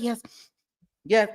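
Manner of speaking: ordinary talk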